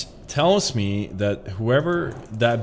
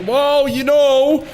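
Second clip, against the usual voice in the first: well, you know, pitched way up and stretched slowly; high drawn-out sing-song; 12 semitones higher, 6 dB louder, slower than usual. Pretend. Streamer teasing the opponent, high sing-song. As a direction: float it up high and slow, teasing. Repeated catchphrase, sung out high.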